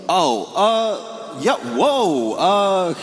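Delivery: high, indignant questioning; suspicious friend. Retold or imitated. Friend offended by goodnight call, high indignant questions. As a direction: challenge why he called.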